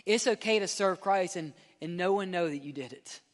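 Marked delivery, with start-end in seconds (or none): none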